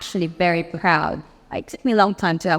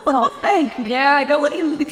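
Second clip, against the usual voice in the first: laughing through the words, a bit higher; laughing thanks; 7 semitones higher than usual. Real, not acted. Thanking the group for a compliment, laughing. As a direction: giggle while thanking the group.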